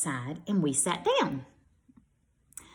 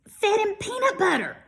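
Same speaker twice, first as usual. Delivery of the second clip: much higher, disbelieving; dramatic disbelief; 14 semitones higher, 3 dB louder than usual. A narrator's reading. Reading how KC fed the bear peanut butter, high disbelief. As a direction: squeal it in disbelief.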